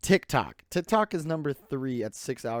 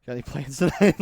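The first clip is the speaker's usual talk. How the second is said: flirting with the wall; 2 semitones higher, 10 dB louder than usual. Pretend. Flirting with a wall while grounded, louder and laughing. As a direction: chat up the wall like a cheesy flirt. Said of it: "I'm like, talk to the wall".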